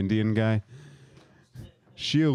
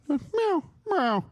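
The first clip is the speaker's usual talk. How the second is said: high mewing cat; cat meow. Pretend. The cat calling the hotline, high meowing. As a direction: meow like a sad little kitty.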